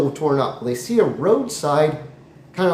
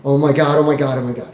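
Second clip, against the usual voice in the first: suddenly louder exclaiming; mock freak-out at raised volume; acted freaking out; 4 dB louder, faster than usual. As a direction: burst out with it after muttering. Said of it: "He's freaking out".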